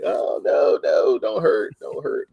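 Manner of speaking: high, loud worried plea; mock alarm. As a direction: plead like an anxious fan watching a hit. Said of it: "I'm like".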